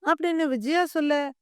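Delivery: plain reading voice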